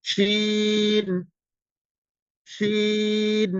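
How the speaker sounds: শিন held long, filling the mouth; drawn-out shin. Shown as a demonstration. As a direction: stretch শিন so the whole mouth fills. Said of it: "আমার সাথে একটু try করবেন".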